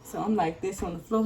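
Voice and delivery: normal talking voice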